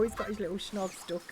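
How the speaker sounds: ordinary delivery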